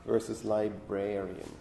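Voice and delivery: ordinary delivery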